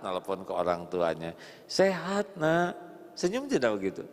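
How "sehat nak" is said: mother's voice. Acted. Mother answers on phone, higher.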